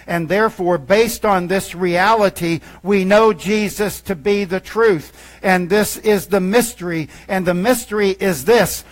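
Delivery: rising full-force sermon voice; preaching. Acted. Pastor preaching to his church, building to full force. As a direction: preach it out to the congregation.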